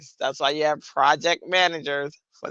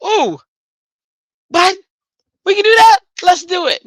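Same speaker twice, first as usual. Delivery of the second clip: high, loud, thrilled squeal; bursting with a new idea, way up high; gushing excitement; 9 dB louder than usual. Retold or imitated. Mocking her own eager self, squealing high and loud. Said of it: "[name] be like".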